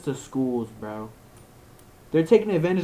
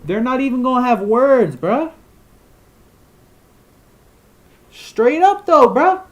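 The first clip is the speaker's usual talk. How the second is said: outraged rant; 10 semitones higher, 11 dB louder than usual. Real, not acted. Outraged rant over AI magazine.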